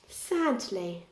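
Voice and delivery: plain reading voice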